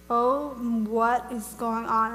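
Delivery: slow, drawn-out dread; stunned disbelief. Retold or imitated. Woman retelling the moment the ground shakes, stunned. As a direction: stretch the words out, stunned.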